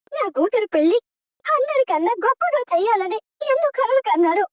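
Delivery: normal talking voice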